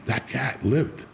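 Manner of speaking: ordinary delivery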